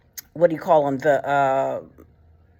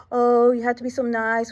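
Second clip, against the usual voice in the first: mock sugary voice; 4 semitones higher than usual.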